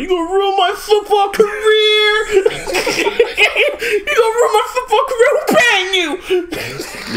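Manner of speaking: high, mock sobbing whine through laughter; mock crying. Friends mocking the crying football player, high mock sob. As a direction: blubber it like a crybaby, cracking up.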